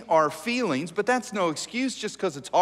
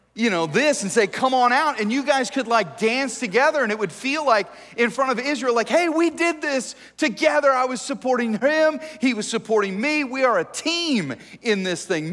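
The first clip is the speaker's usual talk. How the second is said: higher and louder, eager and bright; excited; 7 semitones higher, 5 dB louder than usual. Pretend. Wife imagining dancing with David, higher and louder. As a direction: gush with hope about dancing together.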